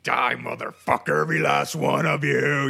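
hammed-up rage roared at the bugs; emotional outburst. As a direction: bellow a curse at the dying roaches. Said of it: "I get emotional".

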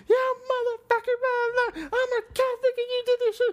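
high, shrill hysterical woman's voice, cursing; mock screaming girlfriend. Mimicking his screaming girlfriend in a high mock voice.

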